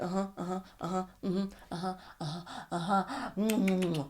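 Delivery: soft, absent murmur, not listening; mock dismissive hums; distracted uh-huh. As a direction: mumble along while thinking of something else. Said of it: "She's like".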